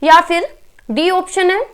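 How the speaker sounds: ordinary talk, nothing marked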